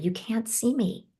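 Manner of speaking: ordinary talk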